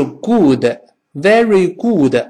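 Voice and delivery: good as drawn-out "goo-de", slow; a clumsy very good with Chinese "u"; mispronounced good. Shown as a demonstration. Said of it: "very good就难听了". (夸张错读 good，像“咕的”)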